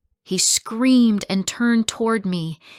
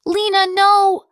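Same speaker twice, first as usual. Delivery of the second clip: much higher, much louder, slow terrified cry; cried out; 14 semitones higher, 10 dB louder than usual. Acted. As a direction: cry out to stop her daughter. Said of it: "my mother cried".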